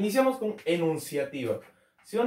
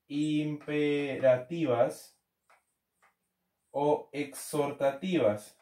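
very slow, drawn-out syllables; slow dictation; 3 semitones lower, much slower than usual. Real, not acted. Teacher repeating the term for students to write, slow dictation. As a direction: spell it out syllable by syllable, slowly.

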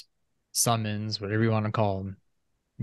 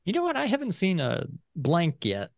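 high voice; 7 semitones higher than usual. Retold or imitated. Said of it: "I'm like".

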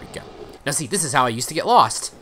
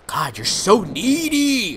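exasperated; 8 semitones higher, 5 dB louder, slower than usual. Real, not acted. Scolding the needy darkness.

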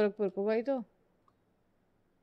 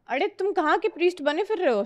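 higher, fast repeat of the tease, toned down; milder teasing voice; 7 semitones higher, 4 dB louder, faster than usual. Pretend. Teasing viewer Adit again, lighter put-on voice. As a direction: ask it again, less put-on. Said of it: "नहीं, priest लिखना था ना।".

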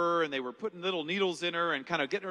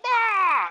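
short high loud yelp of mock panic; panicked yell; 14 semitones higher, 7 dB louder than usual. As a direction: yelp out like a man in a frantic rush. Mock panic while calling priests.

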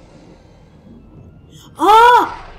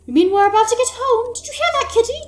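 put-on higher, eager child voice; bright childlike wonder, pitched up; excited little girl; 9 semitones higher, faster than usual. Fran asking her cat about getting home, high and eager.

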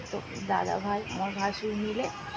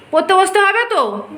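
higher, loud, exasperated insisting; 9 semitones higher, 9 dB louder than usual. Real, not acted. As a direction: push it harder, high and loud. Mother insists child must study.

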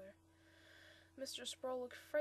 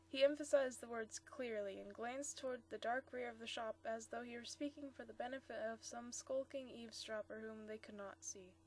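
ordinary delivery, nothing marked

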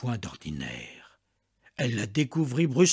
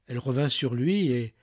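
ordinary delivery

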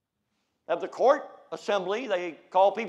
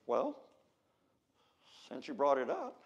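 normal talking voice

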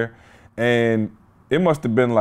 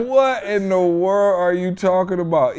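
raised pitch, amused incredulity; retold bafflement; 6 semitones higher than usual. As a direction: replay your bewilderment through laughter. Retelling his baffled reaction while laughing.

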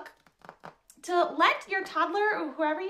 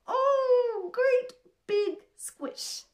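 big loving squish; 7 semitones higher, 4 dB louder, much slower than usual. Acted. Acting out a big hug.

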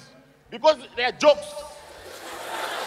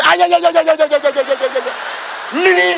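unbothered husband; 4 semitones higher, 4 dB louder than usual. Acted. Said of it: "You say".

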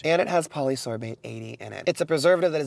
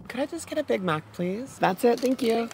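polite ordering voice; 10 semitones higher than usual. Real, not acted. Ordering at drive-through.

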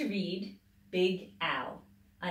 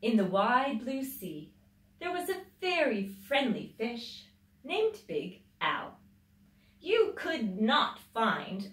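put-on lively read-aloud voice for children; storybook reading; 4 semitones higher, 4 dB louder than usual. A narrator's reading. Mother reading a picture book to children, lively storybook voice.

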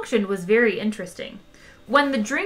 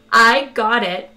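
loud chirpy reply; bursting to obey; eager computer; 8 dB louder, slower than usual. Acted. Ship's computer answering Zaphod, loud and eager.

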